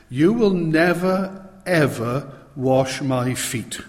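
drawn-out, insistent refusal; emphatic refusal. Retold or imitated. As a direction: refuse absolutely, spacing out NEVER and EVER. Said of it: "it's that emphatic in the original language".

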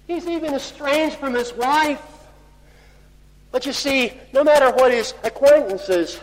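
pitched high, building in force; impassioned preaching. Real, not acted. Preacher pressing Job's estrangement on the congregation, high and impassioned. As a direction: plead it out high and urgently.

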